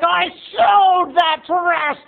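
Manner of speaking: high, drawn-out squabbling screech; hammed-up sisters' fight, screamed slowly; screaming aunt. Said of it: "as Aunt Lauren was screaming".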